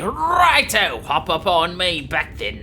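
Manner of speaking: put-on Australian accent. Dragon inviting the Dragon Warrior aboard, Australian accent. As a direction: play an Aussie mentor welcoming a pupil. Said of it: "they're Australian".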